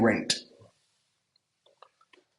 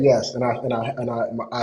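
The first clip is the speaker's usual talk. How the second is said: normal talking voice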